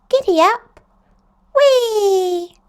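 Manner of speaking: very high, loud giddy whoops; gleeful play. Acted. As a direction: ride the unicorn with joy. Galloping on pretend unicorns.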